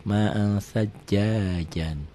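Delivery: "Ma'an" slowly hummed into "sajjajan", no clear first N; held ikhfa. Shown as a demonstration. Teacher shows the reading, "Ma'an" held into "sajjajan". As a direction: hold the nasal of "Ma'an" before the s. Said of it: "Dibacanya gimana?".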